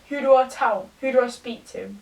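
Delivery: ordinary talk, nothing marked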